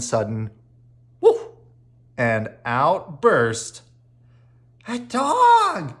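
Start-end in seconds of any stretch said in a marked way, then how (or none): 4.9-5.9 much higher, louder joyful shout; excited yell. A narrator's reading. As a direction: yell it with pure delight. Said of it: "yelled Henry".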